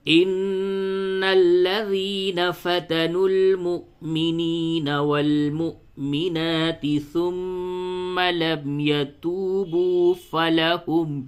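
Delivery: slow, high, drawn-out tajweed chant; Quran recitation. Shown as a demonstration. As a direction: recite it slowly with full tajweed.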